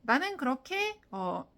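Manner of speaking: ordinary talk, nothing marked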